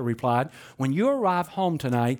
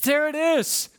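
excited exclamation; 10 semitones higher, 3 dB louder, slower than usual. Real, not acted. Delighted punchline exclamation.

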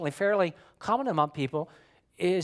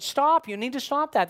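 urgent, higher-pitched warning; bold assertion; 7 semitones higher than usual. Acted. Friend boldly warning someone else, urgent and firm. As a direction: warn them firmly, pitched up. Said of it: "I would boldly assert".

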